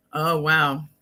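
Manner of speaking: usual speaking voice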